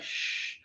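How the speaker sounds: soft unvoiced shush for secrecy. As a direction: hush him like keeping a secret. Retold friend hushing him.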